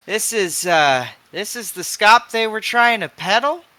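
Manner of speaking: mock the junk they tried to sell; scornful. Hog scorns impure drugs.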